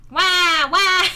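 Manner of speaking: loud fake "wah" wail; two loud mock sobs; fake crying. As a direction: bawl like a cartoon baby, badly faked. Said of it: "I'm crying".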